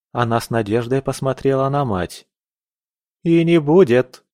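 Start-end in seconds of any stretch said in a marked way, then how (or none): 3.2-4.2 loud, irritated snap on не; mother irritably shutting down hope. A narrator's reading. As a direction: bite the words off in annoyance. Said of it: "раздражённо ответила Шарлотта".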